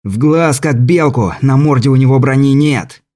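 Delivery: shouted on the run; while jogging. Acted. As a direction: bark orders while running. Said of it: "крикнул на бегу".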